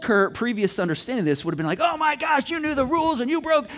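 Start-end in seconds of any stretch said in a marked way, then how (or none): 1.8-3.7 high, acted angry scolding; negative energy. Old Kurt scolding daughter over phone rules, acted outrage. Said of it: "immediately brought the negative energy".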